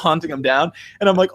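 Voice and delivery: ordinary delivery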